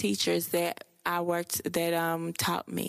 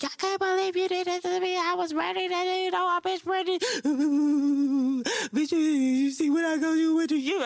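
mock high-pitched hysterical outburst; snapping off; 11 semitones higher, 4 dB louder than usual. Vlogger acts out losing it before calming down, hammed-up snapping off. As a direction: wail it loud and high, hammed-up. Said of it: "snap off and be like".

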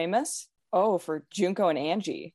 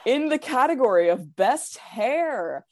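measured, dramatic awards announcer voice. Pretend. Reading out the final superlative results to friends, dramatic announcer voice. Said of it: "dramatic announcer voice?".

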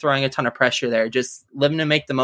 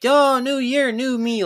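high mock sing-song "new year, new me"; mocking quote; 8 semitones higher, slower than usual. Mocking people who go hard on new year resolutions, sing-song. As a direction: parody a resolution-maker, pitched up. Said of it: "I just don't like the whole".

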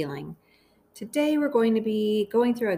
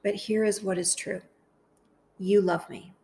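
guided meditation. Acted. Host praying phrases aloud for listeners to repeat, guided meditation. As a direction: lead them gently through it, phrase by phrase, unhurried.

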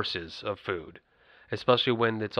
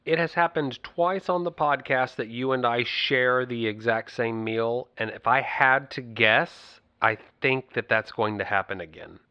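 ordinary talk

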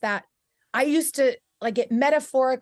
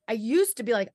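normal talking voice

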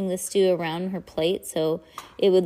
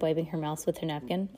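plain reading voice

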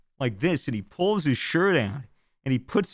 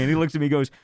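ordinary talk

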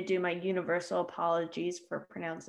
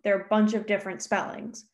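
ordinary talk, nothing marked

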